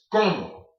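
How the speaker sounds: COMO with the first O hit harder; first O strong in como; stress on first syllable. Shown as a demonstration. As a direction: punch the CO in como, let MO fall. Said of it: "When you have the accent mark".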